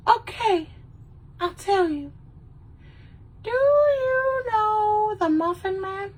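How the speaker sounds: squeaky high little cookie voice, slowed; high-pitched Gingerbread Man voice. Retold or imitated. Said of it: "he's just like".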